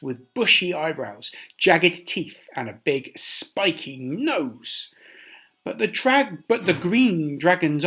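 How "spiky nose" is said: ordinary delivery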